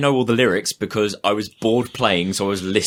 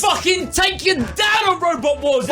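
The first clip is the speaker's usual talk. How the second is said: trash-talk shout. Real, not acted. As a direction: bellow the threat at them. Trash talk over a game.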